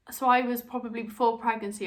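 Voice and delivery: ordinary talk, nothing marked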